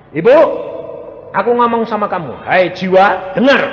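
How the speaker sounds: loud, firm call to the spirit; commanding address to the soul. Shown as a demonstration. Preacher shows how to call a coma patient's soul. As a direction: speak straight to her soul, commanding. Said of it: "Kamu harus ngomongnya gini".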